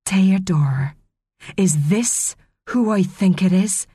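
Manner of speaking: measured, understated threat; quiet menace. A narrator's reading. Lussa confronts Teo about Maria, quietly menacing. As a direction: speak with restrained menace, pausing after the name. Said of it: "in a voice quiet but menacing".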